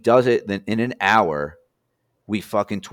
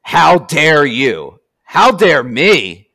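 loud, slow, high-pitched indignant rant; hammed-up furious victim, dragging each word; mock outrage; 8 semitones higher, 9 dB louder than usual. Troll victim blows up at the burner account, mock furious. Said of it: "the fucking guy just goes ape shit".

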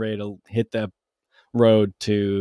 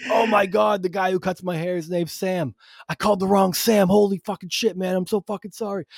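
high-pitched, frantic comic apology; higher, mock-panicked realization; imitating mortified apology; 8 semitones higher than usual. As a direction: gush apologies after a huge mistake. Neighbor's mortified apology for wrong number.

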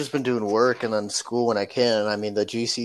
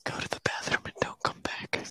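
whispered; 9 dB quieter than usual. Retold or imitated. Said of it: "He's gonna be like".

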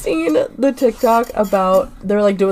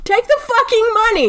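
excited. Real, not acted. Emphatic answer on found money.

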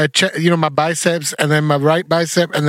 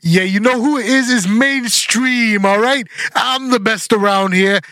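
sneering wrestling villain; 4 semitones higher, slower than usual. Pretend. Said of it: "Do you wanna be heel?".

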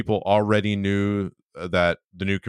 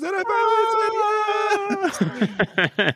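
much higher, slow drawn-out yes; exaggerated yes; slower than usual. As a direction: squeal the yes triumphantly. Excited yes.

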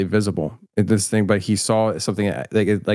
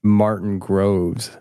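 normal talking voice

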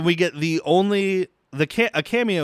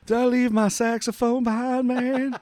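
high-pitched laid-back Clinton; high guy voice; 7 semitones higher than usual. Retold or imitated. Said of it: "That's a better Bill Clinton".

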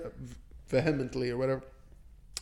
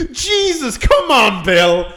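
exasperated lawyer; 7 semitones higher, 5 dB louder, much slower than usual. Retold or imitated. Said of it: "hear the lawyer like".

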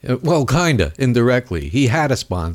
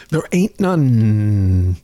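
slow emphatic; 3 semitones lower, much slower than usual. Real, not acted. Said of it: "slow emphatic retort".

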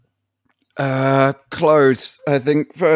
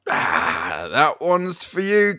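normal talking voice

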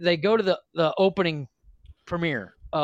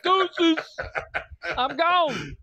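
much higher, singled-out goodbye call; mock breezy farewell; 11 semitones higher, much slower than usual. Retelling the driver walking out, mock high farewell. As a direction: toss off a high-pitched mock exit line. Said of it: "She's like".

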